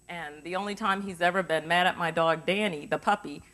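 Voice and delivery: ordinary talk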